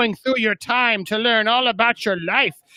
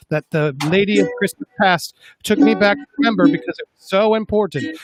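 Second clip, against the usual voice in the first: lower, earnest lovesick robot; robot Vision voice; 6 semitones lower than usual. Acted. Vision confesses his obsession to Wanda in a low voice. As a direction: explain it low and earnest like an android.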